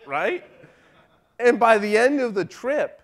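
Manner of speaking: ordinary talk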